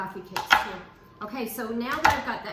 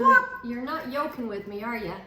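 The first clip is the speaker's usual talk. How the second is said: usual speaking voice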